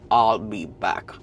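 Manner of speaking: put-on low, slow Austrian-accented Arnold voice; deep Austrian action-hero accent; Arnold Schwarzenegger. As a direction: drop low and slow like Arnold saying I'll be back. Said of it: "in the words of the legend Arnold Schwarzenegger".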